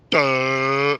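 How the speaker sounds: slack-jawed mock duh; dopey duh. Mocking the YouTuber as a clueless dope, dopey duh. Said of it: "with your thumb up your ass going".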